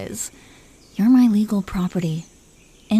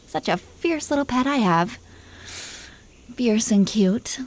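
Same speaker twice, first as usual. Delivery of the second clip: teasing whisper; 4 dB quieter than usual. Acted. Dragon queen whispering to her glaring husband, teasing.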